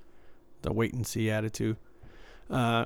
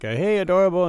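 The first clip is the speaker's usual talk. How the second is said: higher, louder retold call; 7 semitones higher, 6 dB louder than usual. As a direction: call him over by name. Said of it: "I was calling him".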